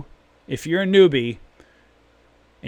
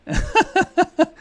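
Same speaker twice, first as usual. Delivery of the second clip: short high-pitched laugh; a quick high giggle; 4 dB louder than usual. Real, not acted. Laughs at own yelling.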